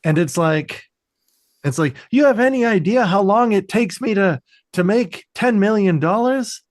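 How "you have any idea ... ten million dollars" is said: high, exasperated rhetorical question; hammed-up outraged questioning; mock indignant boss. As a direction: imitate a boss berating staff. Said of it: "bitching them out".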